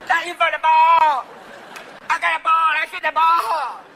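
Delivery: loud, high, slow dopey voice; hammed-up slow jock; dim American player. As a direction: play a clueless happy player. Mocking clumsy American player.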